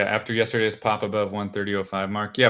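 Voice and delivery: usual speaking voice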